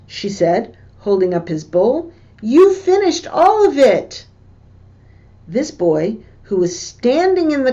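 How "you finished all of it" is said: high, bright excited call; delighted praise. Retold or imitated. Wife holds up the bowl to praise the boy, high and delighted. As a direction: cheer him on with sudden delight.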